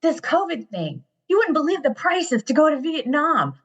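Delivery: high, excited voice of a son pitching a plan; imitated eager son. Son selling mom on a cheap Vietnam trip, imitated excitement.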